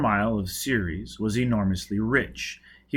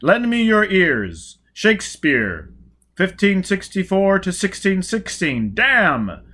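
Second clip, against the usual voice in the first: loud, grand, high declaiming; booming out like a pompous orator; earnest oration; 9 semitones higher, 8 dB louder, slower than usual. Acted. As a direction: overblown earnest speech, much louder. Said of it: "Four Mile began earnestly".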